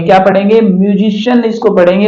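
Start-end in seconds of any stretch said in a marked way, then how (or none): 0.6-1.4 म्यूजिशियन with the ci read as sh; English pronunciation. Shown as a demonstration. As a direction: say म्यूजिशियन as "myoo-ZISH-un" with a sh sound. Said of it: "जैसे म्यूजिशियन इसको पढ़ेंगे".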